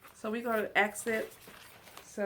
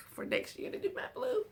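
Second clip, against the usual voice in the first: giggly excitement. Real, not acted. Excited about next year's blue.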